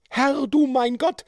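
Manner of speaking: high-pitched indignant matron; indignant woman. A narrator's reading. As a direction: exclaim in a high, scandalised woman's voice. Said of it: "die entrüstete Stimme". (entrüstete hohe Frauenstimme)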